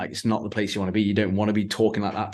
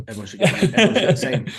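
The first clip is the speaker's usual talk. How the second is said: loud, fast, laughing mockery; mock a preachy idealist, laughing; sarcastic; 5 semitones higher, 7 dB louder, much faster than usual. Sarcastic mock of equal pay.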